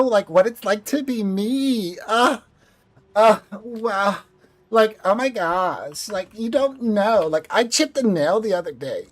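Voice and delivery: put-on high-pitched California Valley Girl; Valley Girl accent. Shown as a demonstration. Valley Girl complaining about a chipped nail, high California accent. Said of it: "it's called the Valley Girl or California".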